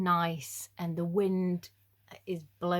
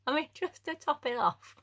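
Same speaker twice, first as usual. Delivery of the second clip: higher, giggling aside; amused aside, higher; laughing; 8 semitones higher than usual. Real, not acted. Laughing at own joke.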